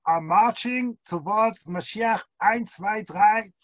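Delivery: mock German marching count; crisp drilled march call; German accent. Rabbi imitates Germans marching to Mashiach, mock German accent. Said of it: "The Germans".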